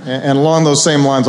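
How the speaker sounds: ordinary delivery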